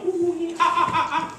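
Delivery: high laughing ooh-ah chimp hoots; high chimp party hoots. Retold or imitated. Lecturer imitating chimps partying with females, high hoots. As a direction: hoot like a happy chimp at a party. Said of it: "they go".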